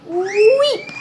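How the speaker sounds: high, loud guinea pig squeak. A narrator's reading. Bertie answers Jenny that it hurts, high squeak. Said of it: "squeaked Bertie".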